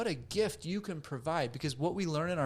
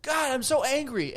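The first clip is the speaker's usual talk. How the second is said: someone venting anger; 8 semitones higher, 6 dB louder than usual. Retold or imitated. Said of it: "when someone's like".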